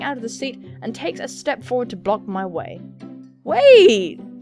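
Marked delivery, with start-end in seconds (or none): 3.4-4.4 high, loud urgent call; sudden acted cry of "wait". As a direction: shout it to stop someone leaving. Character calls out to stop her.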